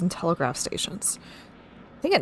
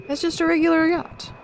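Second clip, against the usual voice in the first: high-pitched, lightly protesting reply; protesting keeper; 10 semitones higher than usual. Acted. Keeper doubting the order to destroy the yacht, high protest. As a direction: object in a raised, high voice. Keeper objects in game dialogue.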